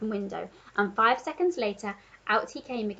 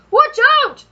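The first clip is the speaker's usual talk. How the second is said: very high, loud panicked yell; Duke shouting in alarm; 14 dB louder than usual. A narrator's reading. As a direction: yelp it as you jump back. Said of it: "the Duke shouted".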